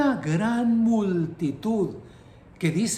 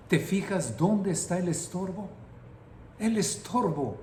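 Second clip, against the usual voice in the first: usual speaking voice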